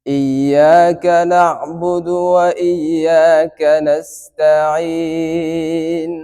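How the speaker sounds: slow melodic tajweed chant with long held endings; Quranic recitation. Shown as a demonstration. Teacher recites Surah Fatiha for the class, chanted. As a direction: sing it out as measured Quran recitation.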